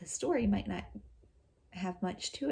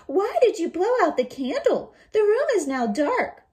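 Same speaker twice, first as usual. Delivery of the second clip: put-on much higher, louder worried child; child asking; 12 semitones higher, 12 dB louder than usual. Eldest child asking the wolf why the candle went out, high and uneasy. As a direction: ask it uneasily in the dark. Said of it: "Sheng asked".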